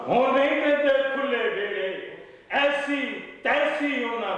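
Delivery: high-pitched declaimed Punjabi verse; poetry recital. Acted. Poet reciting couplets at a mushaira, declaimed recital. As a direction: recite phrase by phrase, repeating lines for the audience.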